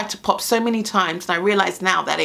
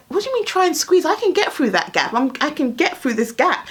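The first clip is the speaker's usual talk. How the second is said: indignant; 7 semitones higher than usual. Retold or imitated. Indignant reply to son.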